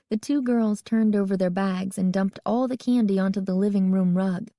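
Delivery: ordinary delivery, nothing marked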